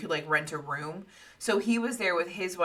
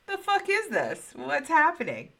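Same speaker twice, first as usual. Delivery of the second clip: alarmed; 10 semitones higher than usual. Retold or imitated. Betty alarmed at moving light.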